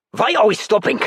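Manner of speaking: loud German accent, fake scientist demanding. A narrator's reading. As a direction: put on a convincing German accent. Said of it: "with an impressively authentic accent".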